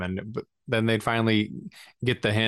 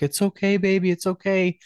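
soothing newborn; 9 semitones higher, 3 dB louder than usual. Retold or imitated. New dad soothing his crying newborn daughter, high tender coo. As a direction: hush her gently in a lilting high voice. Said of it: "I'm trying to comfort her immediately".

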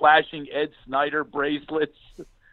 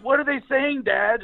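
put-on ten-year-old son; 10 semitones higher than usual. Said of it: "my son's sitting there going".

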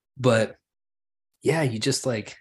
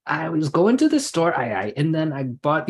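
light El Paso Spanglish lilt; a hint of El Paso ay ay talk; El Paso accent; 6 semitones higher, 4 dB louder than usual. Imitating El Paso speakers with a light accent. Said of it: "So they'll be like".

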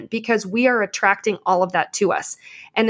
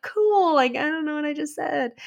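high "cool", then softer and bemused; airy bemused voice; 5 semitones higher, 3 dB quieter than usual. Retold or imitated. Thanked after preaching, she replies airily, bemused. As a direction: sound pleasantly clueless. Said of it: "And I'm like".

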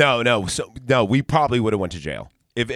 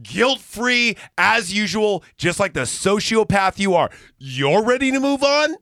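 outraged retold retort; 11 semitones higher, 3 dB louder than usual. Retelling a phone fight with the man ready to move on, outraged. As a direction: throw the words back, high and scandalized.

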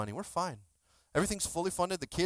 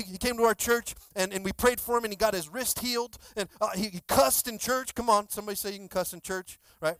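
usual speaking voice

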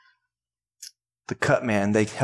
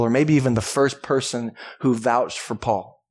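ordinary delivery

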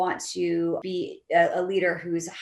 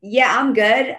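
higher, louder, breezy brush-off; imitating a coder; 5 semitones higher, 8 dB louder, slower than usual. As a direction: wave off the manager like a coder would. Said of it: "coders who are like".

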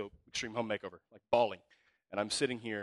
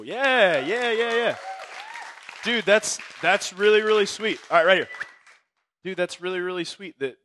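much higher and louder, hyped up; excited cheering; 9 semitones higher, 10 dB louder than usual. Real, not acted. Pastor to the newcomer, loud and thrilled.

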